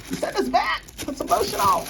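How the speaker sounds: high-pitched mama imitation; mama's scolding. Vlogger quoting mama on dry skin, high mock scolding. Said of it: "As my mama would say".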